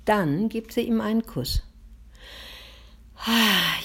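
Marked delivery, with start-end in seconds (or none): 3.2-3.8 contented sigh. A narrator's reading. Boy sighs after mother's bedtime kiss, contented sigh. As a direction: sigh out in satisfaction. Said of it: "Juli seufzt zufrieden".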